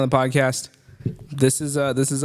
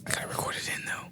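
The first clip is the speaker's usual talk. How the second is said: whisper; 14 dB quieter, faster than usual. Real, not acted. Whispering while recording.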